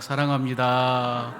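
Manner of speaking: loud and slow, stretched out; big shouted love you. Shown as a demonstration. Congregation cue, a loud slow group declaration. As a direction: shout it out warmly to the room. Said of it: "시작".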